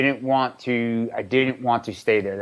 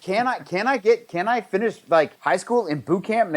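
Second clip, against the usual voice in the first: put-on higher-pitched pleading, as a kid bargaining; retold eager plea; 7 semitones higher than usual. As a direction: imitate your teenage self begging for a way in. Teen retelling his plea to recruiter.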